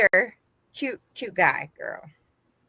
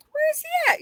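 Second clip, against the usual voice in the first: disbelieving. Retold or imitated. Looking for vanished date.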